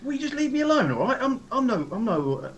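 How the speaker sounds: mildly flustered, high-pitched objection; acted indignant pleading; defensive protest. Acted protest at being patted down.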